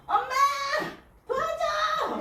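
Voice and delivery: very high, loud cry for help; mountain-echoing shout. A narrator's reading. Mother overwhelmed calls her mother, huge shout. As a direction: holler so the mountains ring. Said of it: "산이 울리도록 큰 소리로 외쳤어요".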